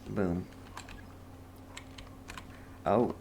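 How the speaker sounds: normal talking voice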